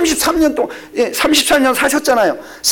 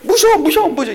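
imitating scared child; 7 semitones higher than usual. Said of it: "하면서".

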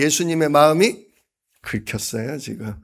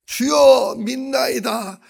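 a higher cry that falls away; 9 semitones higher, much slower than usual. Acted. The father crying to Jesus, a high cry. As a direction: call out high then let it fade. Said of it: "소리를 질러 이르되".

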